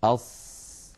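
أص slow, with a held whistling ṣ; ṣād with sukūn, demonstrated clearly; silent ṣād. Shown as a demonstration. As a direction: repeat أص carefully and slowly. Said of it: "هذا بالنسبة للساكنة".

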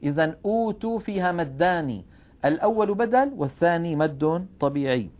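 ordinary delivery

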